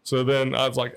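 ordinary talk

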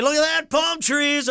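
dad off the plane, a hint of an old man's delight; old guy delight. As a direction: marvel at palm trees like a happy retiree. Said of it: "this is the old guy in me".

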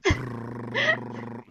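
loud imitated juddering sob; shaking sob. Man out-crying her at the breakup, shaking mock sob. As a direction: imitate a shaking cry like a bike. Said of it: "doing that shaking thing".

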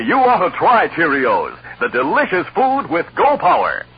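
ordinary delivery

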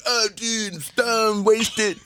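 high-pitched stoned drawl of a buddy; stoned friend. Retold or imitated. Buddy announcing he's wasted, high and giddy. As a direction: lightly play the wasted friend. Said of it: "And now we're stoned".

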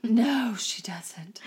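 hushed, breathy aside; whisper. Real, not acted. Whispering a snarky aside to her cohost. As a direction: drop to a whisper for the jab.

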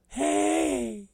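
very high, soft drawn-out falsetto; mocking caller impression. Coworker greeting his boss, high mocking falsetto. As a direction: mimic his needy little hey.

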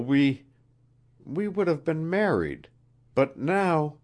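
high, faltering queen's plea; pleading queen. A narrator's reading. Queen confessing her love to Wilson, pleading. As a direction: plead for understanding, halting over the words.

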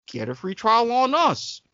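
ordinary talk, nothing marked

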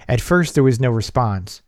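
plain reading voice

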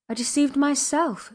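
a pained outburst, kept in check; exclaiming. A narrator's reading. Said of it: "she exclaimed".

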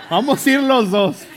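insisting loudly through a laugh; cheerful firm push, laughter in the voice. Retold or imitated. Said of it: "Le dije".

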